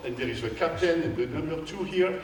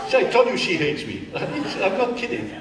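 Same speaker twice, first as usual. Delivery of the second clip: laughing; 6 semitones higher, 5 dB louder than usual. Real, not acted. Joking that she hates him.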